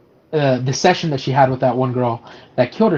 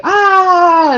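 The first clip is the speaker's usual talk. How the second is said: long, high mock wail, louder; imitated crying wail; 14 semitones higher, 5 dB louder than usual. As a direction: cry out in a drawn-out high wail, imitating her. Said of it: "like, crying. She's like".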